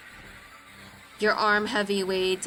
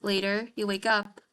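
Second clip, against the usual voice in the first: ordinary talk, nothing marked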